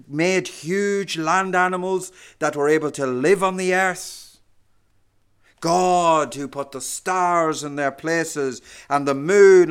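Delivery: loud, rising sermon crescendo; preaching at full force. Acted. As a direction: build the list of God's works with power.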